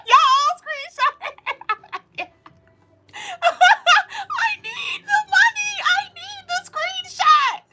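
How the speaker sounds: very high, slow, laughing wail. Real, not acted. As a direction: wail it out in comic begging. Joking plea for screenshots.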